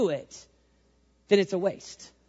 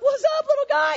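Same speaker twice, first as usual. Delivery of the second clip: high squeaky taunt, cocky; high-pitched playful trash talk; squeaky mock taunt; 4 dB louder than usual. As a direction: squeak it out like a cheeky heckler. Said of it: "being like".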